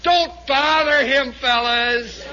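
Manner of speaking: drawn-out projected command; sergeant's called order. Acted. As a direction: shout it back to the men. Sergeant's shouted reply from afar.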